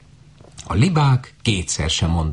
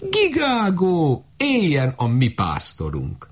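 high, slow goose honk cheer; high cackling goose voice; honking geese; 11 semitones higher, slower than usual. A narrator's reading. Geese cheer the shepherd girl.

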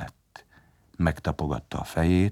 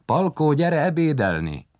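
higher-pitched mother's call to lunch; 10 semitones higher, 4 dB louder than usual. A narrator's reading. Mother calls Palkó in to lunch, calling out. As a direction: call the child in to eat. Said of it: "hallotta anyu hangját".

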